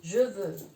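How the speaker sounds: je veux with a firm V in veux; strong V. Shown as a demonstration. Teacher stressing the V sound of je veux. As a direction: lean on the V of veux. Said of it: "la V también se pronuncia bien fuerte".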